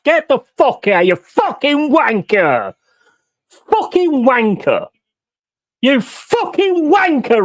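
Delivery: high, cracking rant at the ref; furious yelling. Real, not acted. Fan yelling at the referee on screen, real fury. Raging at the referee's red card.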